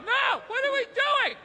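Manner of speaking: very high, tiny pleading voice; in-shape voice. Retold or imitated. The in-shape voice pleading from the back, high squeak. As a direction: squeak like a worried conscience in the back. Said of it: "my in-shape voice".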